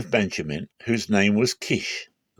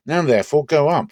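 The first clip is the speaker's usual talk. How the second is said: eager urging; 7 semitones higher, 6 dB louder than usual. Acted. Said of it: "They answered them and said".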